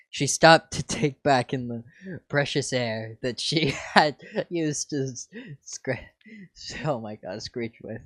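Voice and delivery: plain reading voice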